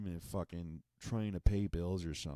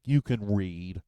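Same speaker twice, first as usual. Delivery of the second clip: louder, pushy mock skeptic; mock insistence; 8 dB louder, slower than usual. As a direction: insist like a know-it-all.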